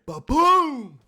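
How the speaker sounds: big booming ba-boom, slowed down; a shouted cartoon crash; loud crash imitation. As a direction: slam out the crash. Mailbox crash acted out.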